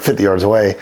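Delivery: usual speaking voice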